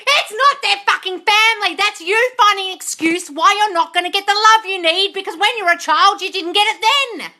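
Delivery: high, loud furious scolding; screaming rant. Real, not acted. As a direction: shout her down, hammer every point. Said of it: "I'm screaming at people".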